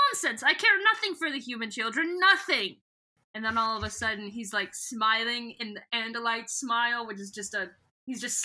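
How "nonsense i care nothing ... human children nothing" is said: high, quick mock-haughty scoff; haughty dismissal. Voicing Visser One dismissing the human children, mock haughty. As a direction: toss it off as a hammed-up alien snob. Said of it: "she's like".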